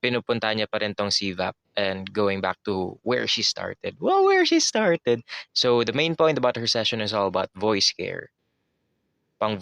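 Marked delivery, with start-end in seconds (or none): 4.0-5.2 very high, loud, drawn-out mock echo; playful high echo. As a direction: sing it out high and slow. Phrase echoed in a silly voice. (pabirong napakatinis na pag-uulit)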